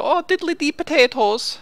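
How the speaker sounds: mock Irish lilt, high and slow; a jokey stage-Irish line; cartoonish Irish accent, pitched high. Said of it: "I could do the accent".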